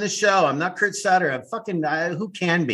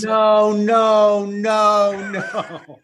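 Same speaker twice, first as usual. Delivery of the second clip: screaming no; 4 semitones higher, much slower than usual. Retold or imitated. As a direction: yell no through a laugh. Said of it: "I'm screaming no".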